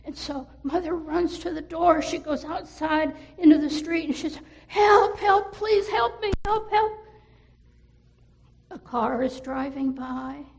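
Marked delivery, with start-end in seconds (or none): 4.7-6.9 high, loud frantic shouts; calling for help. Acted. Mother shouting for help in the street, high and loud. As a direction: yell it down the street.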